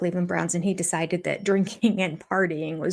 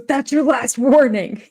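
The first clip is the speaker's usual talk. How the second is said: louder, higher mock warning; stern warning; 6 semitones higher, 8 dB louder than usual. Imitating a staffer shouting a final warning. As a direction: bark the warning like a drill leader. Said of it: "the guy goes".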